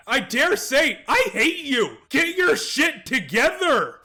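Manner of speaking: normal talking voice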